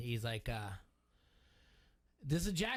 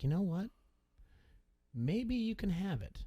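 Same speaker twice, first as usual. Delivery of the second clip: higher, drawn-out mock consideration; acted-out teasing maybe, pitched up; mock pondering; 5 semitones higher than usual. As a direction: tease it out as if mulling it over. Acting out teammate pretending to consider.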